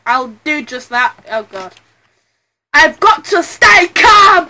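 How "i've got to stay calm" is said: louder, slow insistent self-command; steady yourself out loud; stern loud reminder; firm shout. Acted. Panicking lawyer line, first take.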